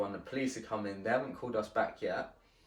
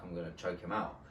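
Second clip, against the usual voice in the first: quiet aside; 5 semitones lower, 6 dB quieter than usual. Retold or imitated. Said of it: "I said".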